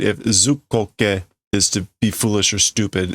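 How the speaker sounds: ordinary talk, nothing marked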